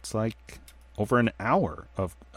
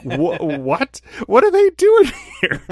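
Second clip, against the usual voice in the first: high, loud, laughing shriek of surprise; what?! pitched way up and loud, through a laugh; stunned disbelief; 10 dB louder, slower than usual. Retold or imitated. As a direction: squeal as if you can't believe who just walked in. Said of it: "I'm as surprised as Kenan Thompson".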